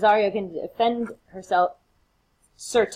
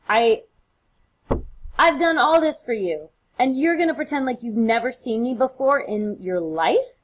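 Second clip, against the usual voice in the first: hurt and furious at the betrayal; acted outrage; 6 semitones higher than usual. Antonio outraged at Cesario's denial.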